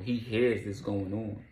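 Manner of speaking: ordinary talk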